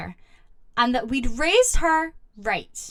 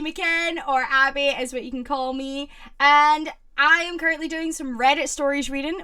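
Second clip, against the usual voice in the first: usual speaking voice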